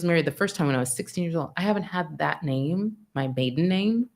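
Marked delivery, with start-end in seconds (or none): none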